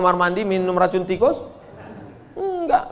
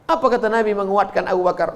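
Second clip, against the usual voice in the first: ordinary talk, nothing marked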